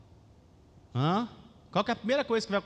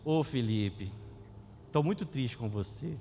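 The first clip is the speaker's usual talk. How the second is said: imitating pastor's sad scolding; 5 semitones lower, 5 dB quieter than usual. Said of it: "a mãe falava assim, ó".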